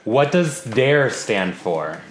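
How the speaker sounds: slow and clear, talking to Alexa; slow command to Alexa. Real, not acted. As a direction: spell it out slowly for the device. Asking the voice assistant.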